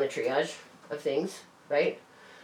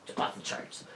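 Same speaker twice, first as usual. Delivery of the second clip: whispered, voiceless repeat; whispered aside; 12 dB quieter than usual. Real, not acted. Repeating the point to the listener as a whispered aside. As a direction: drop to a confiding whisper.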